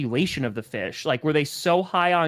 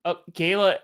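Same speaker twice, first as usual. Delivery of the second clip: ordinary talk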